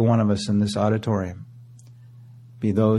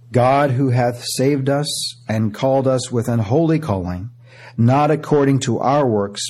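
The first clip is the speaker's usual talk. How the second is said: raised, ringing scripture reading; preaching scripture; 3 semitones higher, 3 dB louder than usual. Acted. Preacher reading Romans to the church, ringing. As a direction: proclaim the verses to the congregation.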